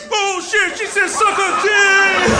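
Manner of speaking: high, drawn-out crowd shout; acted hysterical outburst, loud and high; friends losing their minds. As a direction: imitate friends hollering in disbelief. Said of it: "they just lost their mind".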